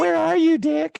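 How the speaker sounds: very high, loud mock cry; Cherry calling out. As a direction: squeal the name as a worried movie damsel. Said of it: "Poor Cherry".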